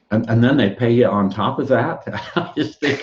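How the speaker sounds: breaking into laughter; amused laughing. Real, not acted. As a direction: laugh through the end of the remark. Joking about being paid, laughing.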